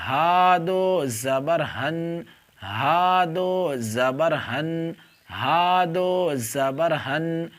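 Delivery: slow, syllable-by-syllable letter drill; slow spelling drill. Shown as a demonstration. Teacher drills the letters with do zabar for the pupil. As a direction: spell each letter slowly and clearly. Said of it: "حمزہ کے اوپر جو آپ دو نکیر دیکھ رہے ہیں".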